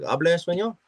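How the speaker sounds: imitating strangers asking, way up high; mock eager stranger. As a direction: squeak it out like a curious stranger. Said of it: "and just like".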